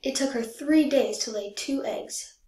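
plain reading voice

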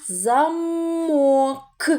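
"замок" slow, high and stretched, К popped apart; detached last sound. Shown as a demonstration. Teacher asks the last sound, К split off "замок". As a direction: stretch "замок" slowly, then release К alone. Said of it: "Який звук чуємо?".

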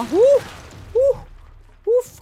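sharp high loud yelps; startled yelps. Real, not acted. Gamer yelping at the terrain breaking apart. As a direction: yelp in shock as the ground breaks.

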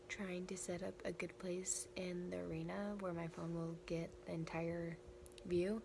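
hoarse, fading voice; barely any voice left; losing voice. Real, not acted. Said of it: "started losing my voice".

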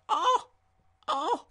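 high, acted little "oh"s; mock excited gasps. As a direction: act out being thrilled, high-pitched. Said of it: "And you're like".

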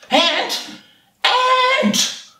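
high-pitched searching call; hungry anteater calling for its prey. Acted. Anteater calls for the missing ant.

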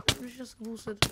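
ordinary talk

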